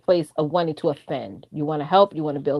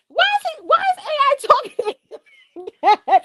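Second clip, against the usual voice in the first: very high, squealed through laughter; giggling disbelief. Real, not acted. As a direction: squeak the question out while cracking up. Cracking up while retelling her reaction.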